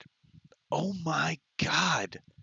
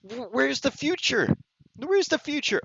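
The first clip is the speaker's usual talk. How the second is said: exasperated; 11 semitones higher than usual. Real, not acted. Ranting at futuristic film.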